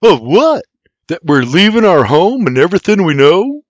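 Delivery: put-on high, loud sarcastic boy voice; sarcastic snap. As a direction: snap it back, full of sarcasm. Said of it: "Sarcastically, William snaps".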